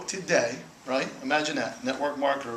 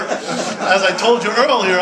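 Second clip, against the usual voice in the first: drawn-out, laughing, louder and higher; slow laughing emphasis on a repeated line; 8 semitones higher, 11 dB louder, slower than usual. Real, not acted. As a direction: stretch each word out through a laugh. Repeats the phrase, slowed, laughing.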